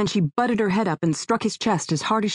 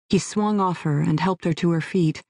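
usual speaking voice

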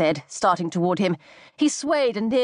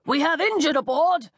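higher call across the dock; shouted; 7 semitones higher than usual. A narrator's reading. Commander shouting to the sailor on the dock, raised call. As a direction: commander calling out to the sailor, hailing. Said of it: "the commander shouted".

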